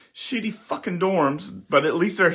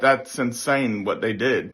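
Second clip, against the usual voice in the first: ordinary talk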